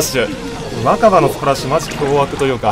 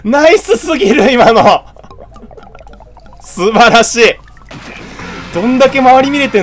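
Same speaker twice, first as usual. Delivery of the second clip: excited praise; 7 semitones higher, 6 dB louder, slower than usual. Real, not acted. Praising teammate's play.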